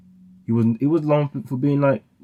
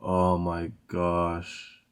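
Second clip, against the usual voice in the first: dismayed; 4 semitones lower, 5 dB quieter, much slower than usual. Real, not acted. Dismayed reaction to drill beat.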